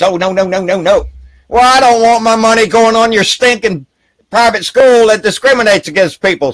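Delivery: indignant rebuttal. Real, not acted. Answering anti-abortion taxpayers, an indignant loud retort. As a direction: throw their argument back at them. Sarcastic then angry rebuttal.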